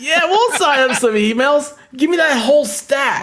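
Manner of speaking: high, loud eager agreement. Retold or imitated. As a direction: jump at the offer happily. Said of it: "And I'm like".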